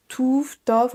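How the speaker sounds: touf as toof, tof with an open O; guessed mispronunciations. Shown as a demonstration. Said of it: "on ne sait pas trop comment le prononcer". (touf, tof, prononcés à la française)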